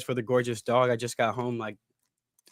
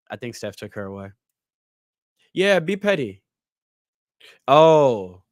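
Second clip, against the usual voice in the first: ordinary delivery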